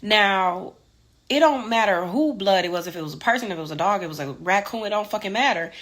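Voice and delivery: normal talking voice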